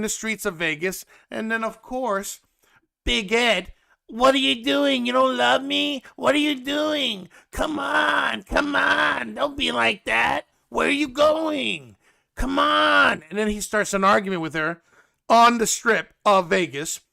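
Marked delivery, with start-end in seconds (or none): 4.1-13.2 high-pitched mock pleading whine; drawn-out, wailing plea to come back; exaggerated needy boyfriend begging; Big Ed.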